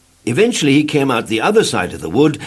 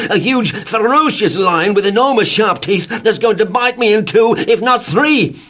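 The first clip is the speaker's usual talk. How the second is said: panicky building cry; 10 semitones higher, 5 dB louder than usual. Acted. Mr Jelly panics over lion.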